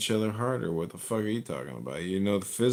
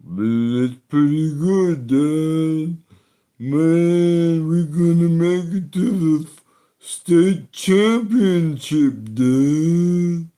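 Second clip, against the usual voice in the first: loud, slow dopey football son voice, pitched higher; dumb jock son; 9 semitones higher, 11 dB louder, much slower than usual. Acted. Comic voicing the football son to his dad, loud dopey drawl. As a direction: ham up a brain-damaged jock, loud and dragged out.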